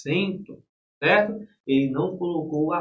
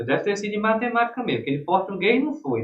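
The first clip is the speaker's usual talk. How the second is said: usual speaking voice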